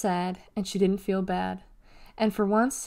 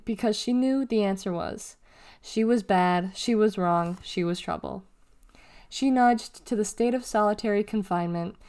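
ordinary delivery, nothing marked

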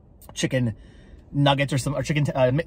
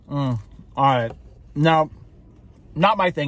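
eating a fry while speaking; talking while chewing; slower than usual. Real, not acted. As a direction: mouth partly full. Seen 2: bites fry at 0.2, chews while talking until about 3s.

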